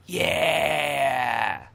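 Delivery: loud triumphant whoop; excited shout. Pretend. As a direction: cheer it out like a win. Cheering after beating game.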